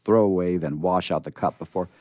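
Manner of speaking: ordinary delivery, nothing marked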